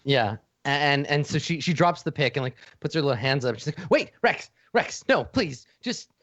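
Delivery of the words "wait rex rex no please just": frightened plea. Acted. Creature pleads with Rex.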